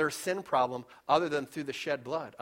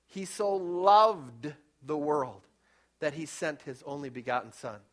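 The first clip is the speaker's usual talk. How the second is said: slow emphasis; 2 semitones lower than usual. Real, not acted. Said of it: "verse slowed for emphasis".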